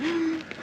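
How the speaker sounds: high, exaggerated gasp for air; hammed-up breathless gasp; mock gasp. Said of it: "and he was like".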